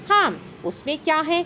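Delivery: a hint of an obliging older brother; agreeable elder brother.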